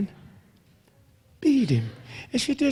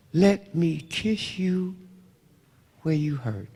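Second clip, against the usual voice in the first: slow, soft, lower tender mother's voice; 6 semitones lower, 4 dB quieter, slower than usual. Retold or imitated. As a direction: ease it out gently, a mother comforting. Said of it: "Only as a mother can say".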